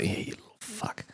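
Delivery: hushed, voiceless mock contempt; muttered insult. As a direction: say it under your breath, barely voiced. Muttered insult to disliked players.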